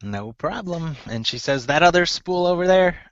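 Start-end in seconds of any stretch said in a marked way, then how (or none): 0.0-1.0 soft, higher gnome voice; lightly voiced gnome, quiet and raised in pitch. Gnome seamstress answering thanks, soft gnome voice. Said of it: "let me get my gnome voice on". 1.7-3.0 high-pitched gnome woman. Acted. Gnome seamstress asking about the copper spool, high gnome voice. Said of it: "let me get my gnome voice on".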